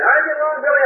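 imitating a neighbour calling out; friendly greeting. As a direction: hail him warmly as he passes. Another greeter voiced in story.

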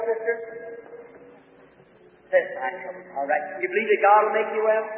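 ordinary talk

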